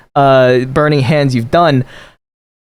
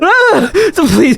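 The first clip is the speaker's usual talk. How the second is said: terrified shriek; 12 semitones higher than usual. Acted. NPC screams, begs.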